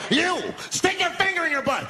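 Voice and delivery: high-pitched barked order; making demands. Acted. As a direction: command a hostage like a crazed captor. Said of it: "he starts making demands".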